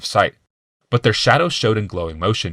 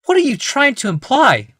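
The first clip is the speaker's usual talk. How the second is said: much higher and louder, offended; angry wife; 5 dB louder than usual. A narrator's reading. Wife confronting husband's insinuation, angry high voice. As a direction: bristle at the accusation. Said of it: "her eyes grew angry".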